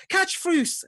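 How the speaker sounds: very high, loud shout; brother's urgent yell. Acted. Brother throwing something to the player, urgent high yell. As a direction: scream it as an urgent call. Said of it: "And he goes, goes".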